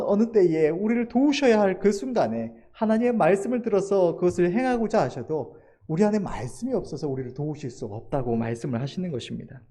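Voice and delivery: ordinary talk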